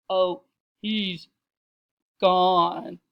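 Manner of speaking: loud, stately, with a pause; big refined matron; deep, cultured voice. A narrator's reading. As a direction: announce it grandly, pausing before the last word. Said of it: "her deep, cultured voice".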